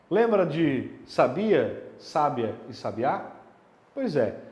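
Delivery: ordinary delivery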